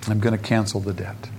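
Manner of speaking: low, softened pronouncement; quiet mercy. Acted. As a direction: let the agent relent quietly. Agent quietly relents after the plea.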